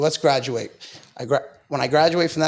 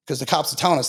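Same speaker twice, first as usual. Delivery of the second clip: ordinary talk, nothing marked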